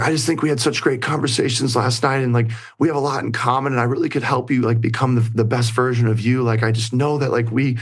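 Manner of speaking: high-pitched imitation of her pleading; mock tearful plea, high and insistent; retold tearful pleading. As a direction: voice her begging for a chance, pitched well up. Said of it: "she starts crying".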